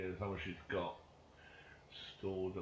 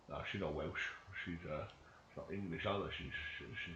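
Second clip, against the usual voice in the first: normal talking voice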